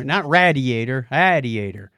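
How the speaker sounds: usual speaking voice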